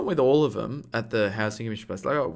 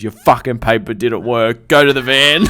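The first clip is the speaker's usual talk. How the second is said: loud retold telling-off, building to a shout; frustrated yell; 10 dB louder than usual. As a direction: order them away, getting louder to the end. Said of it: "he was getting so frustrated with me".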